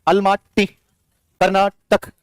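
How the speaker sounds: each word stretched very slowly; slow memory chant; slow linking drill. Shown as a demonstration. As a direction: draw out Almaty Karnataka so the link sticks. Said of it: "link up करो".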